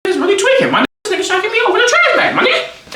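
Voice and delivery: very high, fast incredulous squeal; high-pitched disbelief. Acted. Acted disbelief at being kicked out.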